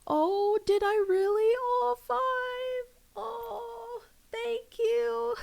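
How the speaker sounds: high, loud, teary surprised thanks. Real, not acted. Streamer thanking a viewer for a gift, high and teary. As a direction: gasp out a touched thank-you. Reacting to a donation, touched.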